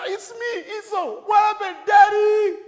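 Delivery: high, loud pleading shout; calling out to dad. Acted. Esau answering his father, high distressed shouting. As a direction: holler it up high, wailing on Daddy.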